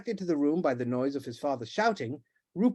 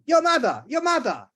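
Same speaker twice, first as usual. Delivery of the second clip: loud, much higher frantic yell; screaming; 12 semitones higher, 6 dB louder than usual. Acted. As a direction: scream for the wife. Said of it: "all the while screaming".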